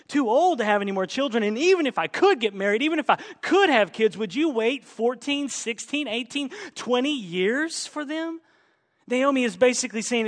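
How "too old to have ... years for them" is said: high, fast, louder protest; exasperated plea. Acted. Naomi arguing with her daughters-in-law about waiting, exasperated. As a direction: rattle it off as an insistent argument.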